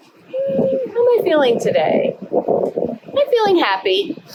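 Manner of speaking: kindergarten teacher voice. Shown as a demonstration. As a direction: show kids how to answer. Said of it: "models an answer for kids".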